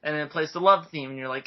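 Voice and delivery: ordinary delivery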